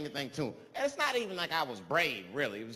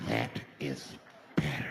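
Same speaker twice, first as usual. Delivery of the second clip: very low, measured, creepy; menacing low murmur; slower than usual. Acted. Man savors the silenced bus, low creepy satisfaction. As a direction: drop right down, word by word.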